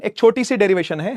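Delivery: ordinary talk, nothing marked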